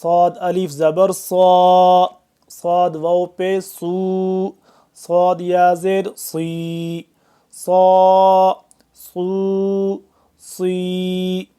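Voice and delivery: صا with a thick, hissing ص; heavy whistled ص. Shown as a demonstration. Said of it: "سیٹی کی آواز اور اس کے ساتھ ساتھ موٹا".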